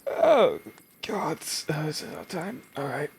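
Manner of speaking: high, sleepy yawning voice; yawning awake. Acted. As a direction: yawn the words out as you wake. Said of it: "the jaw unhinges as she yawns awake".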